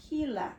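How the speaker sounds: Gila said "HEE-la", with an H sound; correct pronunciation. Shown as a demonstration. Reader explains the letter G, Gila pronounced "HEE-la". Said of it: "it's pronounced".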